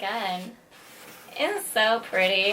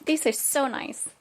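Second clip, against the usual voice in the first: ordinary talk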